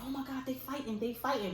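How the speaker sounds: excited schoolkids. Retold or imitated. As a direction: imitate kids spreading news of a fight. Imitating excited kids reporting a fight.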